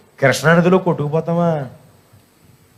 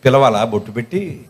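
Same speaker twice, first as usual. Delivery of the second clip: ordinary talk, nothing marked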